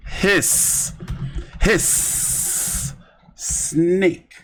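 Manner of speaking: drawn-out hissing at a fighter's name; snake hiss. Pretend. Streamer jeering a boxer he dislikes, hissing like a snake. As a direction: hiss like a snake to jeer him. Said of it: "We want more hiss".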